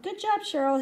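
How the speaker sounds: upbeat, lifted shout-out; cheering praise. A narrator's reading. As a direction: call out praise to a friend. Boy cheering on performers.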